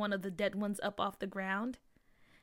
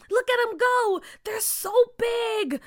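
excited; 11 semitones higher, 6 dB louder, slower than usual. Acted. Kids see the swarm.

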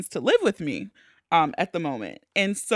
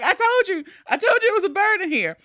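tag; 11 semitones higher, faster than usual. Retold or imitated. Acted vindication to mom.

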